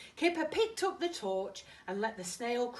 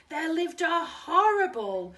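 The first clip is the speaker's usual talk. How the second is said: dramatic loud; 9 semitones higher, 12 dB louder than usual. Acted. Spooky story climax.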